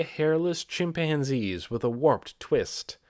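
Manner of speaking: usual speaking voice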